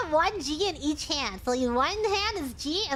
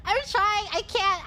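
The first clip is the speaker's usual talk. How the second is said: ordinary talk, nothing marked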